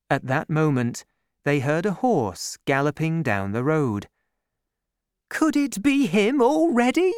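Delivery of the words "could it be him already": high, loud eager cry; excited cry. A narrator's reading. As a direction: call out in hopeful excitement. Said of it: "cried Madame Bonacieux".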